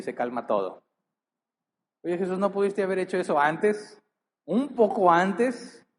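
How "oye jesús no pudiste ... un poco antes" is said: higher, slowing mock whine of a soaked Pedro; half-playful voice of a fed-up disciple; imitating Pedro's complaint. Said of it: "Imagínate, iba Pedro".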